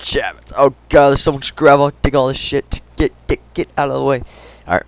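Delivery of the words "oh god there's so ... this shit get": ordinary delivery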